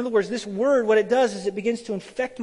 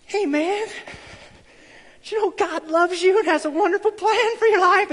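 much higher, louder nervous rush with a nervous laugh; scared nervous pitch; 12 semitones higher, 7 dB louder than usual. Retold or imitated. Young [name] pitching faith to a stranger, high scared squeak. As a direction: squeak it out like a terrified beginner. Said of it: "I got so freaked out and scared".

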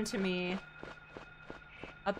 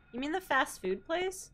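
normal talking voice

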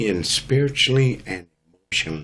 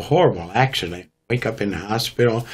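usual speaking voice

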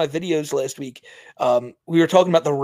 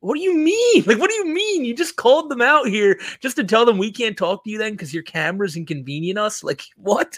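high-pitched incredulous protest; exasperated disbelief; 5 semitones higher than usual. Acted. Streamer answering Sally's excuse to the cops, incredulous. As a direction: throw the question back, pitch way up.

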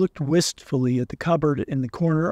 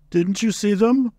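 higher, mildly surprised question; a little surprised; 4 semitones higher than usual. A narrator's reading. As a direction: ask it with a touch of surprise. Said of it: "a little surprised".

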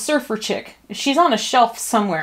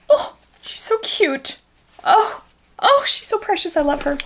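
cooing; 9 semitones higher than usual. Real, not acted. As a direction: coo over a cute doll. Cooing over doll.

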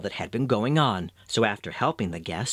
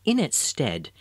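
plain reading voice

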